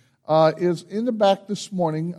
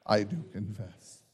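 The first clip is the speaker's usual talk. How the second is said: softer, lower murmured "I do confess"; quiet repeat; 7 semitones lower, 7 dB quieter than usual. Shown as a demonstration. Pastor repeating the reply with the congregation, softer and lower. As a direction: let "I do confess" sink to a low murmur. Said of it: "then declare by saying".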